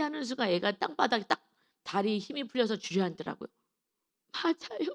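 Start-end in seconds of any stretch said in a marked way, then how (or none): 4.3-4.9 put-on crying child. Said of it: "하면서 막 울기 시작하는 거예요".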